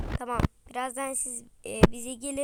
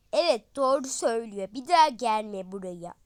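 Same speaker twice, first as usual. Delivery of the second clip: mocking agreement; 3 semitones lower, 6 dB louder than usual. Second friend joining in.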